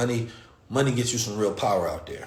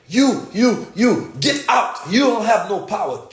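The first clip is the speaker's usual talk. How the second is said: loud, higher imitated command; ordering them out; 9 semitones higher, 5 dB louder, slower than usual. Owner orders police out of the cafeteria, loud. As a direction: throw them out with force.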